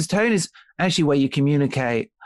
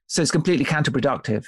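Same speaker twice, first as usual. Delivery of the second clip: ordinary delivery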